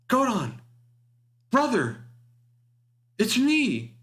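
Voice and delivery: very high-pitched sisterly call; Zakata calling out. Acted. Sister hails her brother over the ship's intercom, calling high. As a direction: call each phrase high, with pauses between. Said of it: "you hear Zakata again".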